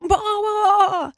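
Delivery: very high, long bleat; drawn-out high calf cry; calf bawl. A narrator's reading. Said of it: "said Bright".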